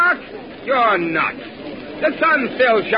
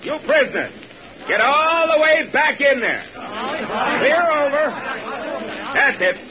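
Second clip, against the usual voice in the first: raised commanding call; calling out orders; 5 semitones higher, much slower than usual. Acted. Corporal ordering prisoners back, raised call. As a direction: bark it across the ravine.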